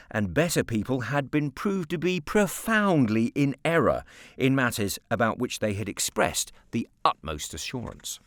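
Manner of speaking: plain reading voice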